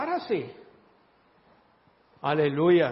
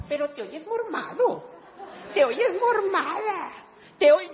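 high, drawn-out mocking voice; mock nosy critic; 8 semitones higher, slower than usual. Preacher imitating a nosy churchgoer, mock taunting. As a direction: needle them about sounding stuffy. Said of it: "no falta la gente".

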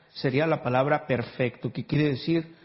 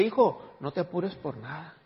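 softer, gentle fatherly reassurance; tender voice of God; 5 dB quieter than usual. Acted. Pastor voicing God softly reassuring his son.

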